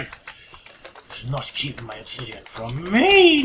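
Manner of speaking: slow, loud scolding; mock scolding. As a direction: scold the creeper, slowly. Scolding the creeper.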